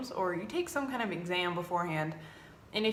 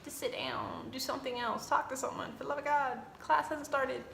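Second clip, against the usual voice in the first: pleading under the breath; 5 semitones higher, 5 dB quieter than usual. Retold or imitated. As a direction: beg them quietly to stop staring. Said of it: "And I'm like".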